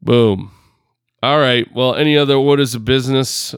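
put-on New York accent. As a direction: wrap it up like a New Yorker. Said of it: "still in this freaking New York accent".

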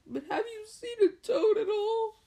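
high, loud, tearful whine. Acted. Tearful boy asks about his toad, high and whiny. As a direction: plead in a high crying voice. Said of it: "He, he looked tearful".

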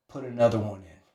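low, soft command; quiet order. Retold or imitated. Coach calmly pushing the smoker further, quiet order. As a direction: give the order coolly under your breath.

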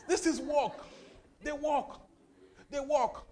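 high, loud repeated declaration; rising preacher's chant; preaching. Real, not acted.

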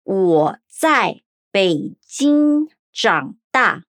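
deliberate, syllable by syllable; 我在北京长大 said slowly as wǒ zài Běijīng zhǎng dà; slow Mandarin demo. Shown as a demonstration. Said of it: "Fala comigo em voz alta".

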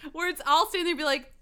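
normal talking voice

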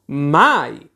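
one loud, furious word; queen's outraged denial. Acted. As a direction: hurl it at him like the inkpot. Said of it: "scagliando con furia".

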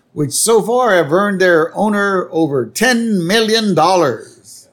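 high, announcer-style delivery; announcer hailing Burns's greyhounds; race announcer. Retold or imitated. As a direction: call it like a racetrack announcer. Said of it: "the announcer goes".

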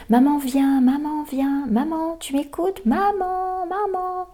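put-on high child voice, calling maman; whiny child calling. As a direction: call out like an impatient little kid. Child calling mother in example.

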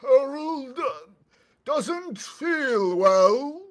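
loud, high, slow ogre call; Harold ogre voice. Acted. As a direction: lure them in as Harold. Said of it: "I will say".

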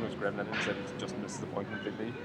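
ordinary talk

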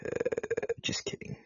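a breathy, toneless little take-back; whispered aside. Real, not acted. Host quietly walking back his rant, whispered. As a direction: drop it to a whisper and shrug it off.

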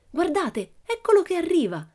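high, loud cry of discovery; thrilled child's shout; excited exclamation. A narrator's reading. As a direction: call out that someone is coming, pitch leaping up. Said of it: "esclamò puntando il dito verso il cancello".

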